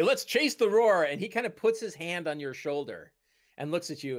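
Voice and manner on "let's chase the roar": loud, high rallying call; mock heroic cry. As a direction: echo the hero's bold declaration. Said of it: "so you say".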